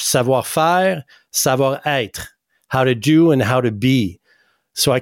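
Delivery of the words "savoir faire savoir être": "savoir faire" and "savoir être" said slowly in French; French pronunciation. Shown as a demonstration. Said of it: "like we say in French".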